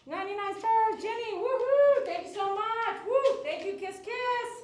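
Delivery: high, loud, squealing thank-yous; thrilled shout-out to a viewer; excited thanks. Real, not acted. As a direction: gush over the gift of stars.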